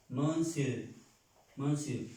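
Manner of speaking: normal talking voice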